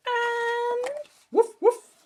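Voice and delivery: high loud woofs; excited puppy woofs; dog barking. Acted. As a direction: bark it out like a happy dog. Dog Bear barks.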